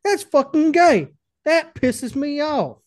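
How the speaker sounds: high, slow, loud comic rant; hammed-up outrage. Friend griping about a YouTube feature, hammed-up outrage. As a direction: stretch each word into exaggerated indignation.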